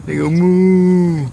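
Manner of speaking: put-on slow, loud moo for a toddler; cow moo demo. Shown as a demonstration. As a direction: show the toddler how cows go. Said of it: "how cows go".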